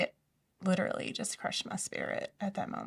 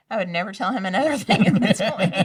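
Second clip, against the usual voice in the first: laughing; 11 dB louder, faster than usual. Real, not acted. Laughing at her own secrecy.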